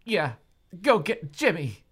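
loud, high eager agreement. Acted. Daughter eagerly urging her father to fetch Jimmy. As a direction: jump at it eagerly. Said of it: "I said".

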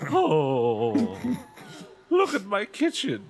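wailing. Acted. Mother wailing.

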